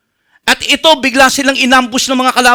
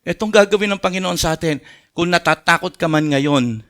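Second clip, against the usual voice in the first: much lower, softer, tender preaching; soft closing exhortation; 11 semitones lower, 7 dB quieter than usual. Acted. Pastor assuring frightened listeners God acts, softly. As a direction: speak comfort gently into fear.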